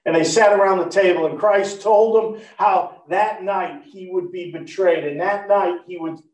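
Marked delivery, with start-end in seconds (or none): none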